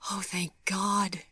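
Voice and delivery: slow, breathy whisper of relief; relieved breath. Acted. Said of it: "she breathed with relief".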